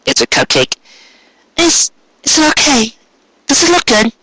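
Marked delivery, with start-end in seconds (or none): 1.6-4.1 put-on a shy, hopeful young mare; Cupcake's voice. Cupcake anxious question.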